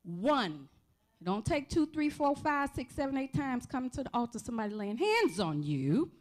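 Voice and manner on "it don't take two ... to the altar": ordinary talk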